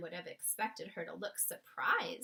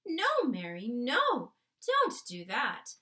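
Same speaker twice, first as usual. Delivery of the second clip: loud sharp command; the queen stops the maid sharply; 9 dB louder, slower than usual. Acted. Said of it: "The queen spoke so sharply".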